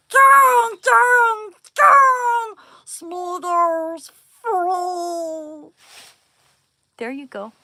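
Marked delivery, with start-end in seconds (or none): 0.1-5.7 very high, very loud shriek, slow at the end; Smeagol shriek. Retold or imitated. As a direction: shriek it out gleefully. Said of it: "I'll do Smeagol".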